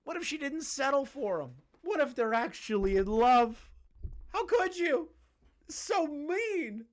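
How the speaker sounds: very high, pleading falsetto; mock whiny outrage. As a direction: whine like a scandalized fan. Mocking the forum's outrage.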